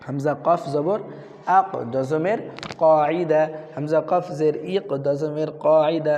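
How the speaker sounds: measured, drawn-out spelling chant; Qaida drill recitation. Shown as a demonstration. Teacher models Arabic spelling to pupils, drill recitation. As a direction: recite each letter for learners to copy.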